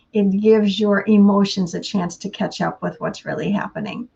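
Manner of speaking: ordinary talk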